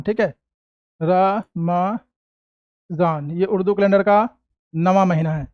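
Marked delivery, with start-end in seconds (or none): 1.0-2.0 very slow ra... ma... zaan for रमज़ान, with gaps; syllable-by-syllable रमज़ान. Shown as a demonstration. Teacher sounds out the month name for writing, very slowly. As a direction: dictate रमज़ान in three separate pieces, ra, ma, zaan. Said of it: "रमज़ान नहीं".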